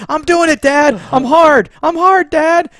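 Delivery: high, loud straining yell; mock yelling. Son yelling back to ghost dad, high and loud. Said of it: "yelling and all this stuff".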